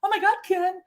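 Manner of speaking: shrill-free high gushing "Oh my God, Ken" way up high; high squeal. Retold or imitated. Woman in parking lot gushing at Ken, high squeal. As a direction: squeal it like an excited fan. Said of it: "not a".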